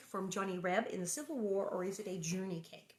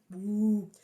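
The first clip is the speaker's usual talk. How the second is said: ordinary delivery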